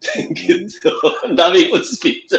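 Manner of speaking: high, giggly sign-off; laughing. Real, not acted. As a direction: talk through bubbling laughter. Host jokes and laughs at sign-off.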